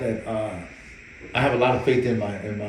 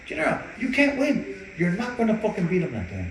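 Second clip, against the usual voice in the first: mock scolding; 8 semitones higher than usual. Trainer arguing.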